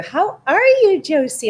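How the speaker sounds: excited greeting. Real, not acted. Greets viewer Josie.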